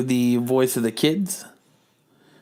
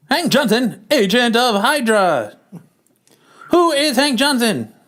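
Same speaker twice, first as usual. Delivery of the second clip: put-on old-timey narrator; 10 semitones higher, 4 dB louder than usual. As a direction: announce the title like a high-pitched old-time radio narrator. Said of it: "me as the old-timey narrator".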